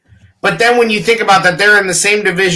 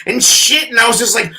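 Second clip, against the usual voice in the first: normal talking voice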